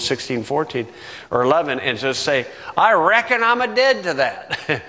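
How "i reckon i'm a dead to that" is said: mild hammed-up Southern accent, higher; Southern twang. Shown as a demonstration. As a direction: say it like a Southern preacher. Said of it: "throw in the Southern twang".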